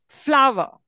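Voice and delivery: "flower" with FLOW stressed; flower, first syllable drawn out and stressed. Shown as a demonstration. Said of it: "always the first syllable is".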